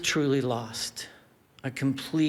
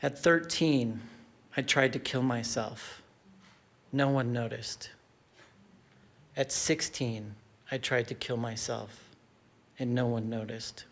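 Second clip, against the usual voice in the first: low, soft, sinking delivery; subdued confession; 3 semitones lower, 6 dB quieter than usual. Real, not acted. Storyteller confessing attempts no one noticed, subdued. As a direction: drop quieter with each admission, leaving pauses.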